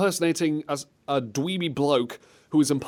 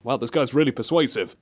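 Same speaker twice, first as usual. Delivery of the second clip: usual speaking voice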